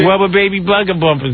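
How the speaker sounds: put-on "Rubber baby bugger bumpers" with the R sounds softened toward w; "Rubber" said like "wubber", R's weakened; says w for r. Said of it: "She can't pronounce her R's".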